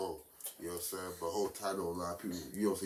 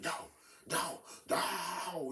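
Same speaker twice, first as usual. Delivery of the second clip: imitating partner's yelling; 10 semitones higher, much slower than usual. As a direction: mimic her drawn-out, high "no". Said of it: "She yelling at me".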